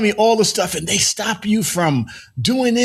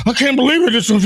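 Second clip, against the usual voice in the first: imitating Roland Martin; 6 semitones higher, 5 dB louder, faster than usual. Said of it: "you could be Roland Martin".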